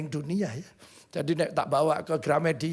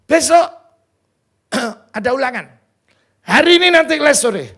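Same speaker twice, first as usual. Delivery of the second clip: loud, raised teacher announcing to class; 7 semitones higher, 12 dB louder than usual. Retold or imitated. High school teacher announces the test to class, loud. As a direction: call it out like a teacher to pupils.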